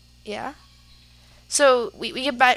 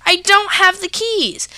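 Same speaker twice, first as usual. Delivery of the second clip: insistent; 9 semitones higher, 7 dB louder than usual. Retold or imitated. Pleading with angry dad.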